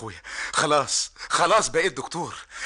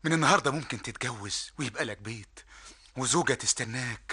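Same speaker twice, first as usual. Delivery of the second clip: lower, gentle promise; tender; 6 semitones lower than usual. Acted. Younger brother tenderly promises his brother a new life.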